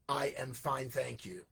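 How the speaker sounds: flat, soft, mechanical recital of a set phrase; robotic stock reply. Retold or imitated. Student in Hong Kong gives the one drilled answer, robotic and soft. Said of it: "So it's very robotic".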